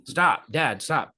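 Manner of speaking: imitating an irritated son, firmer now; growing annoyance. Son to dad who keeps throwing paper balls, growing annoyance. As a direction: push the retold protest a little harder. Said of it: "he's getting angry".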